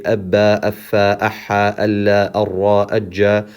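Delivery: short even doubled consonants in abba, affa, aha, alla, arra, ajja; quick crisp hold on each doubled letter, ajja not stretched; ordinary doubled consonants. Shown as a demonstration. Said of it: "you hold them longer than any other".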